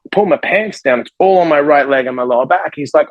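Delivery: ordinary delivery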